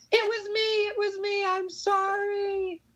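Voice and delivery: very high-pitched apology; squeaky high voice, drawn out at the end; Suitcase voice. Acted. Actor testing a line for fans, Suitcase voice. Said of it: "think of a suitcase line".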